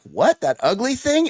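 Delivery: shocked disbelief; surprised. Retold or imitated. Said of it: "they're like".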